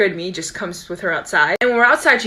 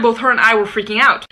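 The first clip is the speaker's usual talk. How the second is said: ordinary talk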